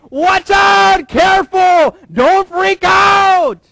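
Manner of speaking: loud, high alarmed shout; shouted warning. Retold or imitated. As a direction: sound the alarm, slow on the last words. Paul's warning, shouted mock-alarm.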